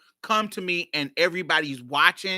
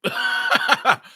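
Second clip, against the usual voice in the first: laugh; 7 semitones higher, 5 dB louder than usual. Real, not acted. Laughing at own story.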